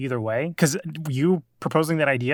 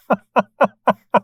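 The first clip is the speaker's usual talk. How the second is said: loud high burst of laughter; laughing; 8 semitones higher, 8 dB louder than usual. Real, not acted. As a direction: let out a big laugh. Laughing at chaotic end.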